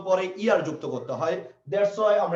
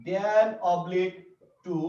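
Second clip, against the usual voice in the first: slow dictation; much slower than usual. Shown as a demonstration. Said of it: "যেমন আমি যদি বলি".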